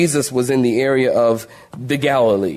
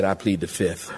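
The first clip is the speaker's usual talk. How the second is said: sheepish mumble; 8 semitones lower, 7 dB quieter than usual. Retold or imitated. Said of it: "And they probably said".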